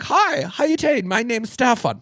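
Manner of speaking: high-pitched mock Bavarian accent; German accent. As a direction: imitate an eager Bavarian in a thick German accent. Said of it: "they had that accent".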